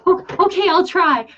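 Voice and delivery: high, sweet and eager; eager little voice. Retold or imitated. Friend promising to try being angry, high and sweet. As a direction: chirp it like an eager kid trying her best. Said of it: "And she goes".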